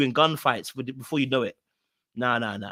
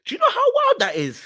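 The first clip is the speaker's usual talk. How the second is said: high-pitched, astonished question; incredulous outburst; 4 dB louder than usual. Real, not acted. Host reacting to how wild that is, incredulous. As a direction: cry it out in high-pitched disbelief.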